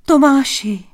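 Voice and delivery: high, loud cry of the name. A narrator's reading. Princess seeing Tomáš back home, crying out. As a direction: call out in amazed joy. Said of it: "Vykřikli společně".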